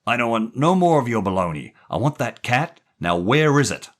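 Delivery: loud, threatening gangster voice; Jerry threatening the boy loudly; angry gangster. A narrator's reading. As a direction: snarl out the threat.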